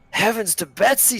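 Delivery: high, loud shocked exclamation; shocked old-timer. Acted. Guard trainer Hardro reacting to the grenade, high shout. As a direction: gasp it out like a startled old-timer. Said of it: "shouts Hardro".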